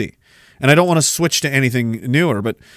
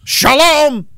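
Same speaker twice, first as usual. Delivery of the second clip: higher, hammed-up shalom; mock greeting; 9 semitones higher than usual. Comedian greeting the crowd, put-on cheery voice.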